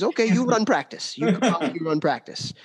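lightly voiced Russian accent. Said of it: "in his Russian voice".

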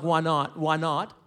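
deflated echo of the friends; mock reluctant agreement. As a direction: go along with it unwillingly. He reluctantly goes along with friends.

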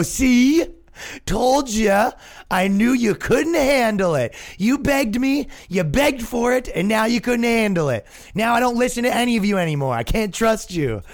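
high, indignant told-you-so; mock scolding. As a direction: scold the crowd as if they betrayed you. Scolds the audience who begged.

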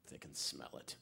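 low, very soft near-whisper; hushed dread. Retold or imitated. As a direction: breathe it like hiding from predators. Fearful aside while praying unnoticed.

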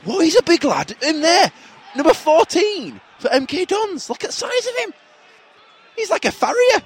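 high-pitched astonishment; amazed commentary. Pretend. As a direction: marvel at the player's size, pitched right up. Amazed commentary at a huge player.

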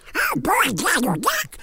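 high squawky cartoon duck; garbled cartoon duck voice; Donald Duck. Shown as a demonstration. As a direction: quack the words out through the cheek. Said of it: "do the voice of Donald and Mickey".

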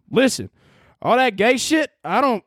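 hammed-up Southern accent; good Southern accent. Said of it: "let me get into my good Southern accent".

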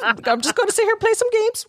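high, silly gamer voice; sing-song goofing around; playful put-on voice. Joking about playing games.

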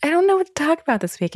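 high, quick mock-helpless; whiny self-mimic. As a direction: squeak it out like a reluctant client. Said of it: "I'll be like".

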